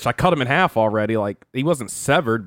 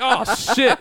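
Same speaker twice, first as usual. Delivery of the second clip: high startled oh shit with a laugh; startled yelp; 11 semitones higher than usual. Retold or imitated. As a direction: jump and laugh at once.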